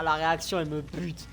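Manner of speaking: usual speaking voice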